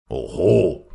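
loud, indignant outburst; angry shout. A narrator's reading. Old champion resents being called old, shouting angrily. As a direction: burst out in wounded pride. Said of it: "schrie Strominger rot vor Zorn".

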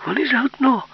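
high, urgent cry; exclaim. Acted. Yulka warning that they are in league, high exclaim. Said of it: "вигукнула Юлька".

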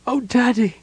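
high-pitched, acted sobbing plea. A narrator's reading. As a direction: wail it like a distraught daughter. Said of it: "Laura sobbed".

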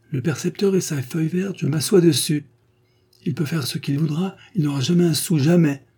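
mock defiance, quoting her back to her; scornful defiant cry. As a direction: fling the words out with contempt. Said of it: "Avec l'air de mépris et de révolte, vous vous étiez crié".

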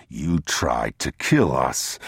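low, slow accusation; unmoved man. Acted. Man reminds the thief of his crime, unmoved. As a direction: answer low and slowly, giving no ground.

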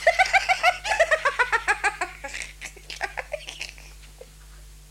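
a long, rising, high peal of laughter. Acted. Wife laughs at the clothespin idea for her husband, long and high. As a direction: let the laugh run on and climb.

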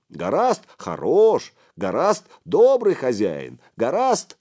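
high, loud, drawn-out praise; eager lackeys hailing their boss; animated henchmen. A narrator's reading. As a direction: pipe up as fawning sidekicks. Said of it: "оживились его шестёрки".

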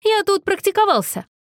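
very high, called-out excuse; high-pitched, moderate-volume shout; calling out. A narrator's reading. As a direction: call an excuse through the door. Shouted exchange through a door.

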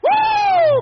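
very high, loud held whoop; ecstatic whoop. Real, not acted. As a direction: burst into a shouted "uh". Preacher whoops at climax. (alarido de júbilo)

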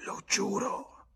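very high, slow croaked oath; hoarse echo. A narrator's reading. Withered bar woman swearing to James, hoarse. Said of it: "venne l'eco rauca".

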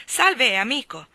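loud, high booming greeting; a brash, bellowing hello; hearty shout. A narrator's reading. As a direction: call across the room with big cheer. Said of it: "gridò".

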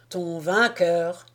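slow, understated pride; proud. A narrator's reading. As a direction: draw it out with a touch of pride. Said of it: "fit orgueilleusement Ambor". (avec une fierté mesurée)

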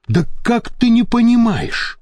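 mild imploring; pleading. A narrator's reading. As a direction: beg to be understood. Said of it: "взмолился Копилкин".

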